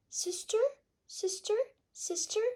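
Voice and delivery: high, fading echo; cave echo. A narrator's reading. The cave echoing back the duck's call.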